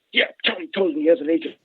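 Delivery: a hint of fast-talking Pesci; Joe Pesci impression. Coworker Dave gossiping about Tony's agent, a light Joe Pesci voice. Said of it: "He's like Joe Pesci".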